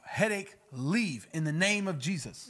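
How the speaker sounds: loud, slow healing command; commanding prayer. Shown as a demonstration. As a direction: order it out with authority. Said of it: "I command".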